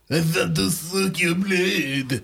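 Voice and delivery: put-on slow, hammy Slavic accent vampire; bad Slavic accent. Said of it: "while speaking in a bad Slavic accent".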